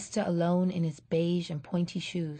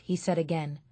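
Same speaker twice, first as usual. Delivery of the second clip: ordinary delivery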